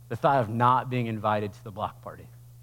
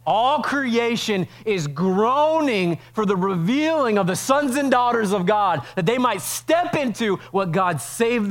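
much higher, louder, slow impassioned preaching; full-force preaching; 10 semitones higher, 7 dB louder, slower than usual. Acted. Pastor crying out to his church about creation groaning, full-force preaching.

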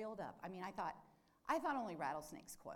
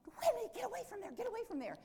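high urgent call to the dog; 6 dB louder, faster than usual. Retold or imitated. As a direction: shout the dog away from the snake, fast. Calling dog away from snake.

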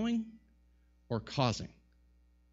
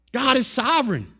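raised, forceful proclamation; emphatic repeat; 9 semitones higher, 7 dB louder than usual. Real, not acted. Preacher declaring God's rule to the congregation, emphatic. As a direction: drive it home, high and loud.

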